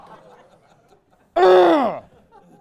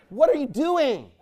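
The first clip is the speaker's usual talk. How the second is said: ordinary delivery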